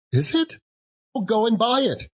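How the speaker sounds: put-on old man squeaking with joy; thrilled Scrooge. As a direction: burst out with glee.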